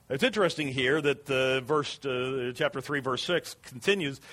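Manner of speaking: usual speaking voice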